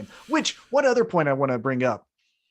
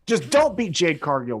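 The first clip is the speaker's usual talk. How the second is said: usual speaking voice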